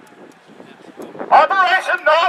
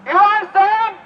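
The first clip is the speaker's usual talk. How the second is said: yelling; 5 semitones higher than usual. Real, not acted. Protester yelling up.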